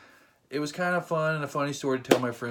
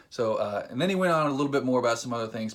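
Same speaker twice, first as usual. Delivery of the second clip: normal talking voice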